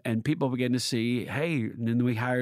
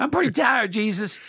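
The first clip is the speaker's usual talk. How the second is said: higher, louder, half-joking weary plea; mock tired prayer; 10 semitones higher, 6 dB louder than usual. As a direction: whine it to Jesus in jest.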